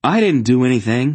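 quick, high, loud denial; automatic protest. A narrator's reading. Boy protesting innocence to his aunt, defensive. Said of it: "he protested almost automatically".

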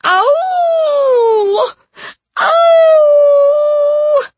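high loud musical wolf howl. Acted. Howling continues.

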